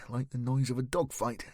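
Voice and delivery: ordinary delivery, nothing marked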